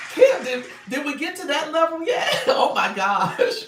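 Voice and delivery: loud, high laughing fit, cracking up; real laughter. Real, not acted. Live host reading a viewer's comment, laughing loudly. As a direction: burst out laughing and shout through it.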